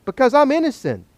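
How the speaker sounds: high-pitched defiant challenge; escalating dare. Acted. Job upping his challenge to God, high defiant dare. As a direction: push the dare up high, insisting on it. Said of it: "he's gotta up it".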